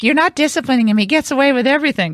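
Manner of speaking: lightly voiced jealous daughter; jealous daughter complaint. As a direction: imitate a sister protesting unfairness. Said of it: "She's like".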